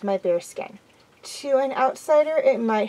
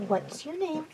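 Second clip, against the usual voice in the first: soft, higher murmured question; 6 semitones higher, 8 dB quieter than usual. A narrator's reading. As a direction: ask it very gently and quietly. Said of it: "I murmured".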